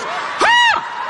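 very high mock-offended "ha"; shocked gasp. Woman just denounced on the plane, high shocked yelp. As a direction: imitate her scandalized high yelp. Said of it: "She goes".

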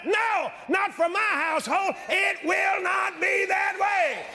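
high, shouted preacher's declaration; fervent pulpit shout, high and emphatic; saying it out loud. Retold or imitated. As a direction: proclaim it defiantly at full force. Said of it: "I say it out loud".

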